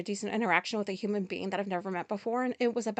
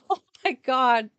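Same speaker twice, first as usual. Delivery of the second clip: laughing exclaim; 3 semitones higher, 8 dB louder than usual. Real, not acted. Recovering from laughing fit.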